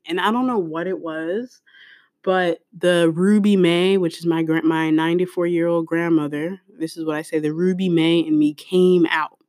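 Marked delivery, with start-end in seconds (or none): none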